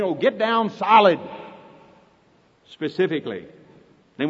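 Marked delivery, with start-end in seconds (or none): none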